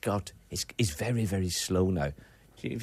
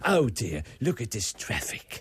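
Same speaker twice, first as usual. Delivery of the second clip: practised impression; slower than usual. Shown as a demonstration. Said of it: "That's it".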